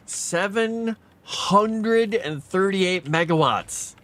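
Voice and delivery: stunned repeat. Real, not acted. Reads huge power draw aloud.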